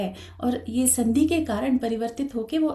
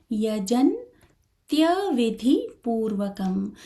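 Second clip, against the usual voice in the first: यजन्त्यविधिपूर्वकम् spoken slowly, each half letter clear; slow careful reading; much slower than usual. Shown as a demonstration. Teacher spelling out the word for students, slow and careful. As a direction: pronounce यजन्त्यविधिपूर्वकम् syllable by syllable. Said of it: "यहाँ आपको dash दिखाया जा रहा है".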